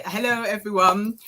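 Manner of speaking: ordinary talk, nothing marked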